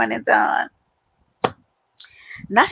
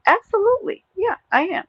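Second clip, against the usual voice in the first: proud high boast; 12 semitones higher than usual. Real, not acted. Proud New York boast.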